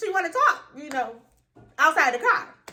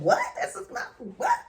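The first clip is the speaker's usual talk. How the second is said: normal talking voice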